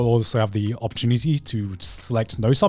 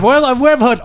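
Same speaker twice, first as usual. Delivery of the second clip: puzzled high voice; 11 semitones higher, 6 dB louder than usual. Retold or imitated. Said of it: "I was like".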